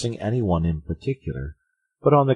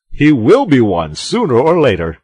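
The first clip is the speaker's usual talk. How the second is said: loud, higher emphatic proclamation; crying out; 8 semitones higher, 13 dB louder than usual. A narrator's reading. Porthos insists Aramis will take the cassock, crying out. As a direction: insist on it at high volume. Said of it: "cried Porthos".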